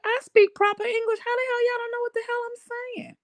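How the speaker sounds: high, huffy mock indignation; offended New Yorker. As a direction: sass the Southerners who don't get you. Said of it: "'Cause I was like".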